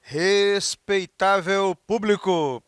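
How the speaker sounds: slow booming ringmaster call; loud carnival barker. Shown as a demonstration. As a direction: announce it like a circus ringmaster. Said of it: "eu posso dizer".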